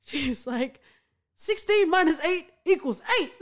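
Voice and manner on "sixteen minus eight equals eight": bright deadpan quote, said dead straight; deadpan Colleen. Retold or imitated. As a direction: play it totally straight, like a stern mother. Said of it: "she just plays it so straight".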